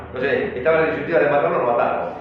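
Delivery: ordinary talk, nothing marked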